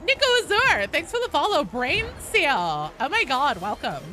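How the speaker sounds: put-on gushing Valley Girl thanks to followers. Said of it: "Can I stop doing the Valley Girl voice now?".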